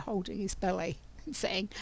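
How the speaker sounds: usual speaking voice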